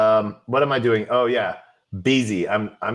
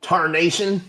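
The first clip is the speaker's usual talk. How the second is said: put-on old prospector; 8 semitones higher than usual. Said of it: "old-timey prospector".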